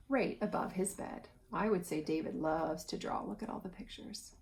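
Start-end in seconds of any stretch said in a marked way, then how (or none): none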